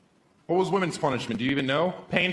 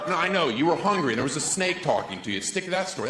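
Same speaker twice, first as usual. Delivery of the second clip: ordinary talk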